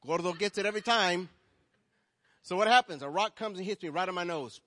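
loud, high storytelling preach; preaching a story. Real, not acted. Pastor telling the congregation his story, loud and high. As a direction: sell the tale to the room.

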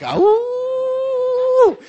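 high falsetto DJ howl; Wolfman howl. Retold or imitated. Preacher howling like Wolfman Jack in the car. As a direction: let out a wolfish whoop.

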